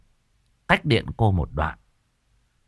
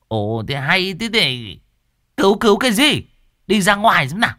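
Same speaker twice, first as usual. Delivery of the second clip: irritated scold; 9 semitones higher, 4 dB louder than usual. A narrator's reading. Cô An shoos the woman.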